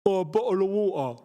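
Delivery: "bottle of water" with both t's as glottal stops; British glottal-stop "bottle of water"; British pronunciation. Shown as a demonstration. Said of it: "the way we say water".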